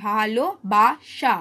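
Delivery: ভালোবাসা said very slowly, syllable by syllable; teacher modelling ভালোবাসা very slowly; slow sounding out. Shown as a demonstration. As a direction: draw out ভালোবাসা slowly for students to copy. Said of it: "একবার উচ্চারণ করে দেখে নাও".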